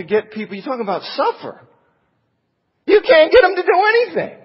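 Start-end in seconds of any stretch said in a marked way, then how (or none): 2.9-4.4 exasperated outburst. Real, not acted. Preacher laments unwilling church members.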